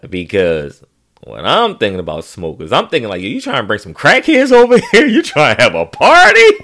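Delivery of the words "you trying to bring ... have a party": high, loud disbelieving questions; can't believe what dad is saying; incredulous. Retold or imitated.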